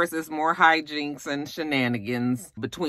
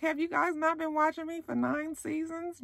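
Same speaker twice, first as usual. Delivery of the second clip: much higher and softer, disbelieving; mock-exasperated question to viewers; incredulous retort; 11 semitones higher, 9 dB quieter than usual. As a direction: ask it like they should already know. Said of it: "I was just like".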